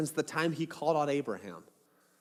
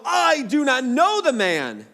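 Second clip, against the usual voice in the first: louder, higher, slowed denial under oath; emphatic denial; 8 semitones higher, 7 dB louder, slower than usual. Acted. Peter denies Jesus with an oath, loud and emphatic. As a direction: swear it hard, leaning on know. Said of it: "he denied it with an oath".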